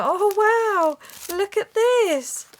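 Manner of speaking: high, slow squeal of delight; delighted gasp. Real, not acted. Crafter opening a swap gift, squealing with delight. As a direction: gush over the gift, drawn out.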